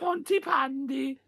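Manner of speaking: slow, high fake Welsh accent; [name]. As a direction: stretch it out in squeaky mock Welsh. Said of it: "not the worst accent you've ever done".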